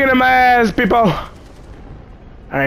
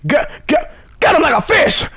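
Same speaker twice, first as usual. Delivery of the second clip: much higher, stuttered, punchy gut him like a fish; gleeful repeat; 7 semitones higher than usual. Real, not acted. Player gleefully echoing the kill prompt, higher. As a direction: relish the line like a hype man.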